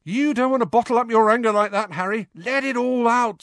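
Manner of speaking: high, loud, jolly teasing; beaming. Acted. Fred teases Harry about his anger, beaming. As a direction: egg him on cheerfully. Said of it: "also beaming".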